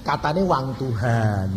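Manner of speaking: normal talking voice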